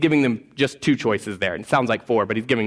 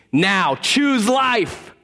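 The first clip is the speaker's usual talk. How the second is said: authoritative; 7 semitones higher than usual. Retold or imitated. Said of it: "he says very clearly and authoritatively".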